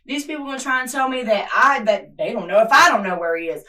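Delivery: shouting out her frustration at the whole room; real anger. Real, not acted. Mother demanding her missing son.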